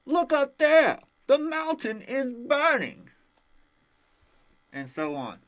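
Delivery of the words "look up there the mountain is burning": high, loud alarmed cry, the last words slow; acted shout. A bystander warning everyone that the mountain burns, shouting. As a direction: yell it out in alarm as the smoke appears. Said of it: "someone shouted".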